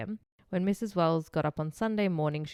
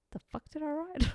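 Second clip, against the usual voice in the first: baffled; 10 semitones higher than usual. Retold or imitated. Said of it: "I'm like".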